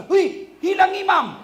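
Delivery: loud startled cry, pitch jumping up; startled shout. Retold or imitated. Worshipper shouting when the imam vanishes, startled shout. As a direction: blurt it out like a shocked worshipper.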